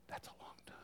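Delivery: "That's a long time." whispered softly, savoring it; hushed whisper of wonder. Acted. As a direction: breathe "That's a long time." as a delighted secret. Contrast hell versus heaven.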